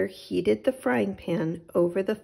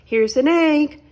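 high, loud happy shout; excited shout; 7 semitones higher, 9 dB louder than usual. Acted. Boy shouting to his mother about the egg. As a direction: call out the find proudly. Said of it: "shouted Jack".

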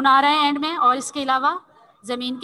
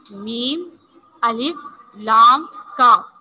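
letters drawn out one by one, louder; slow spelling; 3 semitones lower, 6 dB louder, much slower than usual. Shown as a demonstration. Teacher spelling the word again for children, slow and loud. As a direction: spell each letter slowly for the class. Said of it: "क्या बना है, मालिक".

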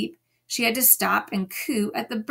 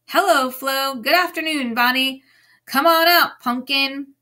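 higher and louder sing-song pet talk; cooing; 5 semitones higher, 5 dB louder than usual. Retold or imitated. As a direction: coo to the hens like babies. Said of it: "She looks inside the coop and coos".

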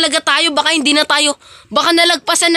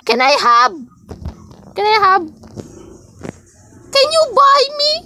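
high, whiny put-on voice; squeaky begging voice; 9 semitones higher than usual. Fake angel begging for halo-halo in a squeaky voice. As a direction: beg then refuse like a bratty child.

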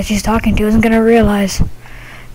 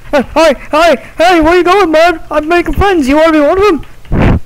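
eager ghost voice; 7 semitones higher than usual. Acted. Ghost chases a visitor.

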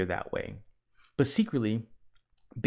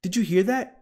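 high, louder question; startled, pitched-up check; alarmed question; 10 semitones higher, 7 dB louder than usual. A narrator's reading. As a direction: ask urgently after hearing a cry. Fireman alarmed by cries.

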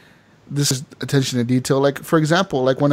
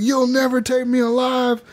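defiant taunt; 9 semitones higher than usual. Pretend. Fleeing the law drunk.

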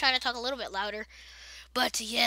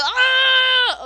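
sudden high scream at a dragon; startled yell; 11 semitones higher, 12 dB louder than usual. Real, not acted. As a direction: yelp out loud in shock. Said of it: "If I'm yelling too much".

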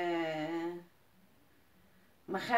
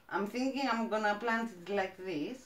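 ordinary talk

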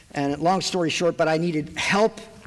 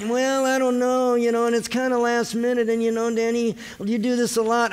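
whiny excuse voice; 5 semitones higher than usual. Retold or imitated. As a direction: stall and complain. Mocking reluctant helper's excuses.

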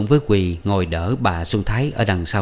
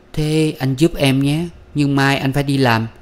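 put-on young woman asking; 6 semitones higher than usual. A narrator's reading. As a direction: ask a favour in a higher feminine voice. Quỳ asks him to help. (giọng cô gái nhờ vả, cao)

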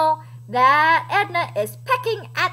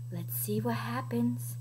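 hushed, much softer aside; soft, secretive storyteller whisper; suspenseful whisper; 4 semitones lower, 12 dB quieter than usual. Real, not acted. Hushed suspense before page turn.

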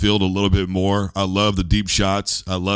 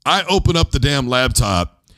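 usual speaking voice

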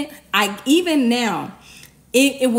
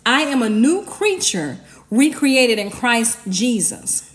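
normal talking voice

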